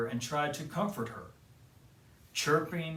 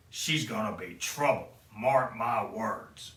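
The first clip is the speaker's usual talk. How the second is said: Grumpy's voice; 3 semitones lower than usual. Acted. Grumpy grumbles.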